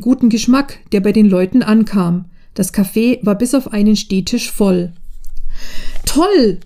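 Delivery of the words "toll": ein hohes, begeistertes Toll; rief begeistert. A narrator's reading. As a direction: staunend ausrufen. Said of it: "rief Svenja". (begeistert ausgerufen)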